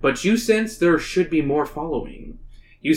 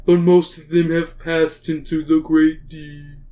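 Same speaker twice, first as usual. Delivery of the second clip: whale voice; 4 semitones higher, slower than usual. Acted. Narrator voices the lead whale.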